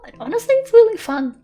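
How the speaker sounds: high, loud excited burst; excited gush. Real, not acted. To viewers about TNT Wizards, excited high gush. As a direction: suddenly gush about the game.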